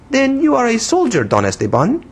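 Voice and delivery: put-on high, childish foreign-accented English; broken English in a little-girl pitch; broken English, infantine. A narrator's reading. Young woman scolding the man who startled her, childish foreign accent. Voices Pepita in dialogue.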